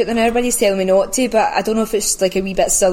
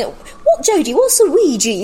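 puzzled asker; 9 semitones higher, slower than usual. Retold or imitated. People asking Jodie what the word means, in a high mock-puzzled voice. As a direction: squeak it out like a baffled outsider. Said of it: "people always ask me".

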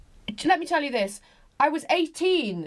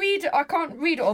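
ordinary talk, nothing marked

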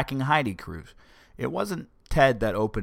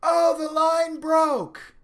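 much higher, slow, put-on cry of alarm; mock alarm; 3 dB louder, much slower than usual. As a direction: play the prankster captain faking panic. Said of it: "the captain of the ship just went".

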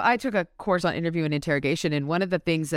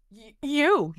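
higher-pitched stuttered start; startled stammer; 6 semitones higher than usual. A narrator's reading. Morty, surprised to find Dresden in his house, stammering. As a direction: trip on the word, caught off guard. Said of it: "he stammered".

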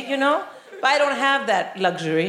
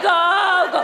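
cheering; 7 semitones higher, 4 dB louder than usual. Retold or imitated. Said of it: "I was like".

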